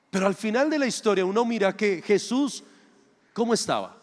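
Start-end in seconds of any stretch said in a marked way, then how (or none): none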